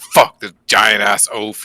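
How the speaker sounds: storming-off curse, hammed up; loud angry outburst; angry rant. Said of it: "So I was like".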